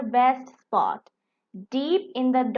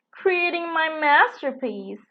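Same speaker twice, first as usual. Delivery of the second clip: exclaiming; 5 semitones higher than usual. A narrator's reading. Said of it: "exclaimed a beetle".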